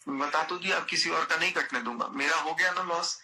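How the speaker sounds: normal talking voice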